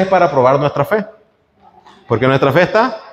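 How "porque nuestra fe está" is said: ordinary talk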